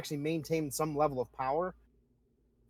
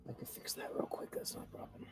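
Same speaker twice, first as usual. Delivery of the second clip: hushed aside whisper; 15 dB quieter than usual. Real, not acted. Game master whispering a quick fix to the players. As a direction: whisper it off-mic to the table. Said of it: "GM murmurs aside, unvoiced".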